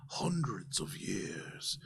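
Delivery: soft, whispery, slow recollection; slow-talking mystic Javaris. Acted. Javaris explains an ancient armor plate, hushed solemn mystic. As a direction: tell ancient lore in a reverent hush. Game master voices Javaris.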